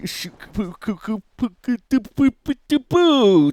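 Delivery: goof around with nonsense syllables; mock stumbling babble; silly syllable babble. Fumbling the name into noises.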